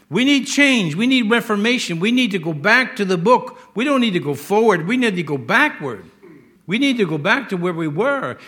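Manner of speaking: high, loud, hammering need; preaching at full force. Acted. Preacher calling church back to the Bible, full force. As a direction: preach it to the rafters.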